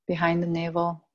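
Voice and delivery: calm, even yoga nidra voice with long pauses; steady, low-key meditation guide, phrase by phrase; guided meditation. Acted. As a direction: lead them inward, unhurried, letting each phrase rest.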